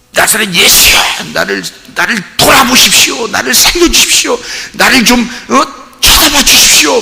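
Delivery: desperate high shouted plea; blind man crying out. Retold or imitated. Blind man shouting to Jesus for mercy, desperate high cry. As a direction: cry out to Jesus over the crowd. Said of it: "막 하면서 소리 지르잖아요".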